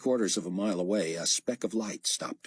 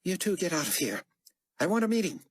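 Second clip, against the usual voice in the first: Carpathia voice; 11 semitones higher, faster than usual. Acted. Carpathia ordering his men out.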